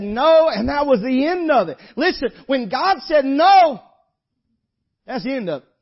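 loud, high hellfire preaching; preaching at full force. Acted. Preacher driving the point home to the congregation, loud and high. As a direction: thunder it out from the pulpit.

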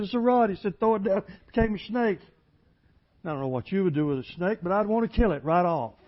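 loud, high, driving pulpit voice; fiery preaching. Acted. Preacher to church, loud fiery sermon delivery. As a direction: preach it hard to the congregation.

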